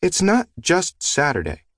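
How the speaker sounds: put-on high, slow, huffy child; huffy little girl. A narrator's reading. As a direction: huff it like an offended little girl. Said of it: "she huffed".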